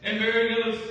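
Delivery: loud, high, fervent sermon delivery; full-force preaching. Acted. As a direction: preach it out to the whole room.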